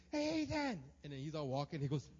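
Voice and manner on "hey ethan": high, loud call from above; shouting down. Retold or imitated. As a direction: call out to a child far below. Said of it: "I looked, I shouted down".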